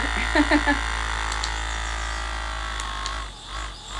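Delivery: real burst of laughter; laughing. Real, not acted. Laughs at the highest setting.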